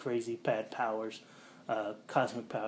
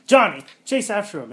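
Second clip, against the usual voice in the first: higher, louder mock command; mock order; 9 semitones higher, 12 dB louder than usual. Reed orders Johnny to chase, loud mock command.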